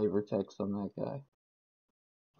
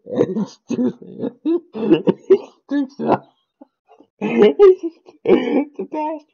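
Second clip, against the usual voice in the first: high, breaking through laughter; can barely get the words out for laughing; laughing fit; 11 semitones higher, 6 dB louder than usual. Real, not acted. To a friend about the library card, laughing hard.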